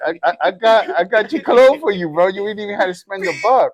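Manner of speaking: put-on loud, high, boastful kid rush; cheesy, souped-up. Boy telling dad he saved him money, cheesy and souped-up. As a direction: brag excitedly as if doing dad a huge favor. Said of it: "I was cheesy about it".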